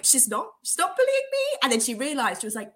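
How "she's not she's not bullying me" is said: a high, protesting friend voice; imitating Tamara. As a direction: squeak out her denial like a meek lab partner. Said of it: "And Tamara was like".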